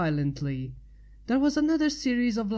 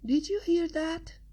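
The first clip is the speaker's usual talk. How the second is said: Pinky voice; 9 semitones higher than usual. A narrator's reading. Pinky voice high.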